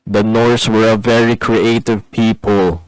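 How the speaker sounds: loud, slow, deliberate aside; mock proclamation. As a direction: announce it ponderously as a deadpan gag.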